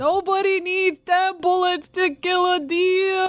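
slow, high mocking whine; high, slow mock of a whiny politician; Cuomo mockery. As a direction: drag it out to mock the governor. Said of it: "you could probably mock that pretty well".

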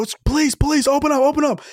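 high, urgent mock cop bark; imitating police banging on a door; cops yelling. Cops pounding on hotel door.

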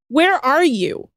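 drawn-out disbelief at her nerve; mock incredulous. Retort to complaining newcomer.

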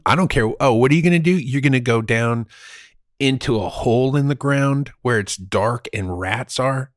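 ordinary delivery

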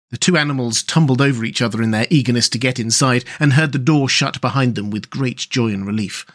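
usual speaking voice

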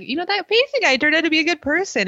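high, fast mock of AJ. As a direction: rattle it off high as a smug AJ. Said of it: "A J's like".

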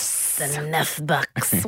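low, slow mock French accent; mimicked French accent. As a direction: echo her French accent, low and drawn out. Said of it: "butchering this accent".

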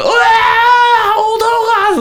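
high, drawn-out wail of pain, slow. Retold or imitated. A kid struck by a stone wails in pain, high and slow. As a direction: howl it like a kid who just got hit.

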